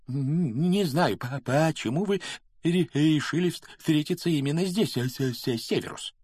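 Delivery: high, halting stutter on first syllables; nervous stammer. A narrator's reading. Quirrell answering Snape in the dark forest, stammering nervously. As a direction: trip anxiously over the consonants. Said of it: "Квирл заикается ещё больше, чем обычно, а значит, он ужасно нервничал".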